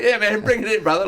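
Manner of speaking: high, big open-armed welcome; mock hearty welcome. Comic answering the miner's request for a hug, high and hearty. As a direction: throw your arms wide, welcome him in.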